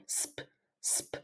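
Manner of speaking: voiceless "sp" breathed out softly; whispered blend. Shown as a demonstration. Teacher whispering the blend for students to copy. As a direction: hiss "sp" with no voice at all. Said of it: "we say it together".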